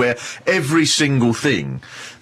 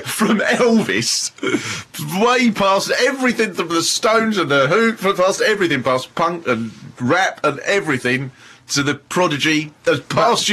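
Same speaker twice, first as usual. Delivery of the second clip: laughing; 9 semitones higher than usual. Real, not acted. Cracking up retelling the joke.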